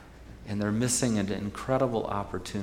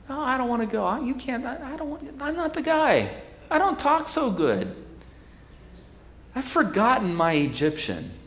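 much higher, pleading excuses; whiny reluctant Moses; 13 semitones higher than usual. Acted. Moses begging God to send someone else, high and whiny. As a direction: squirm out of the job, whining.

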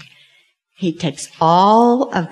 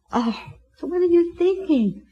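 higher-voiced puzzled kid; a child asking what mom means; curious daughter; 9 semitones higher, 3 dB quieter, faster than usual. Daughter asks mom what she's thinking, higher voiced.

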